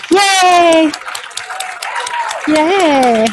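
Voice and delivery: high bright "yay"; cheering. Real, not acted. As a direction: cheer them on. Cheering for promoted faculty.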